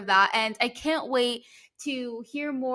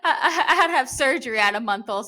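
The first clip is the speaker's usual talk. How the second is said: talking through giggles; laughing; 4 semitones higher, 4 dB louder than usual. Real, not acted. Host joking about infant surgery, laughing. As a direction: let laughter break into the words. Laughing at own baby anecdote.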